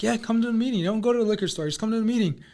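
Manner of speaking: high, bright-eyed urging; eager invitation. Retold or imitated. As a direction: coax her along excitedly. Young people inviting mom to meeting.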